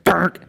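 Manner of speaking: high, abrupt crunch sound effect; imitated loud breaking noise. Storyteller mimicking the front wheel breaking, imitated noise. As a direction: imitate metal giving way. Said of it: "made a loud noise".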